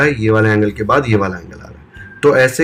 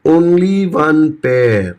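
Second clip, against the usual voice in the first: only one pair, each word drawn out very slowly, only lifted higher; slow emphasis; 4 semitones higher, much slower than usual. Shown as a demonstration. Teacher stressing the key line of the definition, very slowly. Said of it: "ये line बहुत important है".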